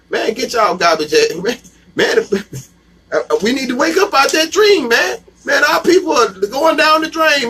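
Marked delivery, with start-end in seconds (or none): 3.4-7.5 preaching shout. Real, not acted. Preacher calls people to wake.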